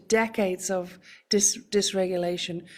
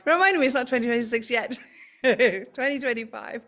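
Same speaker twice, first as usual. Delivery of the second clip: laughing through a quick, higher-pitched correction; giggly self-correction between bursts of laughter; amused laughing; 4 semitones higher than usual. Real, not acted. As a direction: crack up at your own slip. Laughs at her own year slip.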